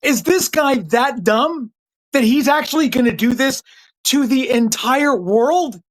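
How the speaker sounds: mock disbelief. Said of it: "They were like".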